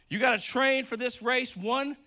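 usual speaking voice

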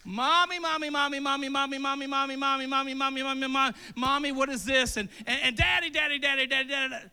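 put-on whiny kid calling mommy over and over; nagging like an impatient child; pestering child. Child pestering parent in sermon.

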